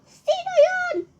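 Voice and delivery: shrill shock. Acted. Shrieks at stranger below.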